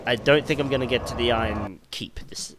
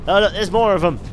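loud, high, excited exclamation; delighted; 8 semitones higher, 10 dB louder than usual. Real, not acted. Gamer spotting more enemies, delighted. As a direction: call it out with glee.

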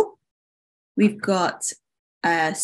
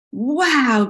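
drawn-out, higher "wow"; gasped wonder; 4 semitones higher than usual. Acted. Spring admires the filling moat, gasped wonder. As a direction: marvel on one long swooping word. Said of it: "gasped Spring".

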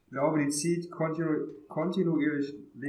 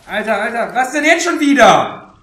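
much higher, much louder, slowing down; frustrated shout; 9 semitones higher, 17 dB louder than usual. Real, not acted. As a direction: yell out in exasperation. Player gets overwhelmed and dies. (frustrierter Aufschrei)

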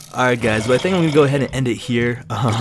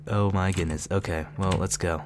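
normal talking voice

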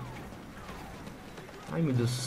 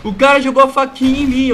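indignant exclamation; 7 semitones higher, 9 dB louder than usual. Real, not acted. Knife thrown at him.

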